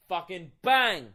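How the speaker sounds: sudden loud burst, drawn out on bang; big dramatic impact yell; explosive shout. Retold or imitated. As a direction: blurt the crash out loud and long. Sudden crash acted out in story.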